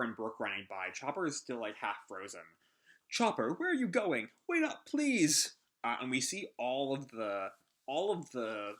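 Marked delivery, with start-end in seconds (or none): 3.1-5.6 panicked character voice. Acted. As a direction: call after him in a panic. Voicing panicked Brook line.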